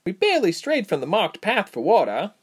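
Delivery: high, insistent protest; interjecting. A narrator's reading. Reshma explaining to the tabby, high interjection. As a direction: cut in defensively, pitched high. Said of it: "Reshma interjected".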